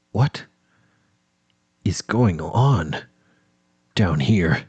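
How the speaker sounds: ordinary delivery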